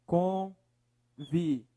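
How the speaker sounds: Convivência broken into con, vi, vên, cia; syllable by syllable. Shown as a demonstration. Teacher dividing the next word for analysis, syllable by syllable. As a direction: separate each syllable of Convivência slowly and evenly. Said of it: "Separe a palavra".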